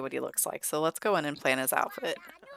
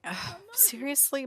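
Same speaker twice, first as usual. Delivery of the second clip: high, exasperated mock complaint; acted-out reluctant dad; exasperated groan; 9 semitones higher than usual. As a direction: groan it high, put-upon. Vance balks at the custody.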